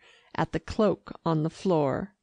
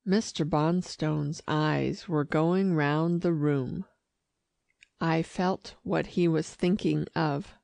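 usual speaking voice

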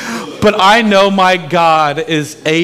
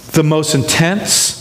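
normal talking voice

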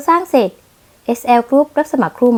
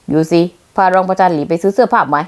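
ordinary delivery

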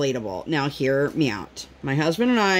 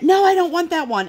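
whiny refusal; 11 semitones higher, 4 dB louder than usual. Retold or imitated. Daughter refusing, voiced high.

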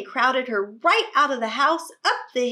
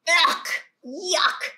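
disgusted; 5 semitones higher, 4 dB louder than usual. Acted. Child disgusted by cow.

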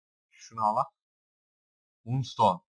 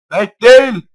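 excited; 10 semitones higher, 18 dB louder than usual. Real, not acted. Excited correction while playing.

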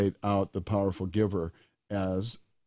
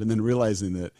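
usual speaking voice

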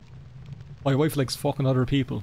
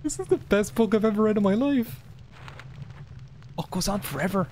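higher, excited gushing; giddy raving; 8 semitones higher than usual. Real, not acted. Gamer gushing over a joke book. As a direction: rave about the book.

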